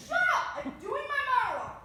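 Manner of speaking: loud, high-pitched yell at hecklers; angry outburst. Acted. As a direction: shout them down, indignant. Boy shouts down heckling in skit.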